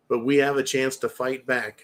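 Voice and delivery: ordinary talk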